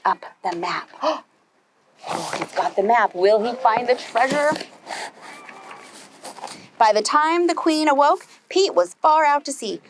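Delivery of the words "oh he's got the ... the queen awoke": ordinary delivery, nothing marked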